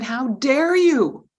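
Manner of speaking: loud, slow indignant yell; angry yell. Retold or imitated. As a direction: drag out each word, outraged. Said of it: "yelled at me and said".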